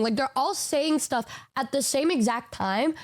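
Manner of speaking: ordinary delivery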